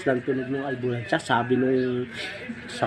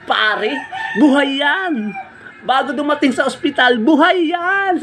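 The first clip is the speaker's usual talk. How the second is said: excited; 13 semitones higher than usual. Retold or imitated. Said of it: "Sabi niya".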